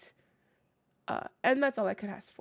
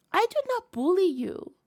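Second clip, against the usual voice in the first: higher and louder, playfully offended; mock indignation; 7 semitones higher, 5 dB louder than usual. Streamer playfully denying she bullied a viewer. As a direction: protest your innocence with a grin.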